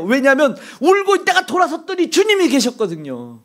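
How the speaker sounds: high, driving sermon cadence; fervent pulpit preaching; impassioned preaching. Acted. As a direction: preach it at full force, rising. Preacher building to impassioned run.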